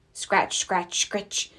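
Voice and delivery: soft, scratchy hoe sound, whispered at the end; hoe noise. A narrator's reading. Peter hears Mister McGregor's hoe nearby, soft scratching noise. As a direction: quietly scrape the words like a hoe in soil. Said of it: "heard the noise of a hoe".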